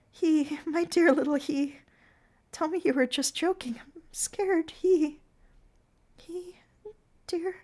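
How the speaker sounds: put-on high, frail, frightened girl; weak faraway voice. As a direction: Consuela pleads with the clown, high and frail. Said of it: "in a weak, faraway voice".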